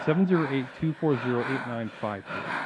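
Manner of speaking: drops away to a murmur; trailing radio host murmur; fading number read. Acted. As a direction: let the number fade out. Repeat, fading.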